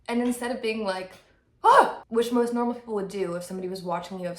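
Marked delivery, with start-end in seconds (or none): 1.6-2.0 sudden loud, high shriek; mock startled scream. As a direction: yelp as if suddenly caught off guard. Imitates a normal startled reaction.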